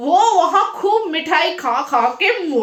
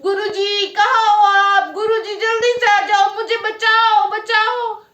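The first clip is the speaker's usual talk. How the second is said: wailing for help; 5 semitones higher, 4 dB louder than usual. Acted. Narayan Das calls his guru to save him, wailing. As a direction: cry out for rescue, hammed-up. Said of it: "रोए जा रहा था".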